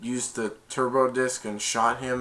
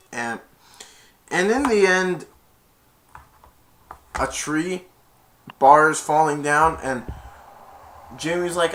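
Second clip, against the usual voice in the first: normal talking voice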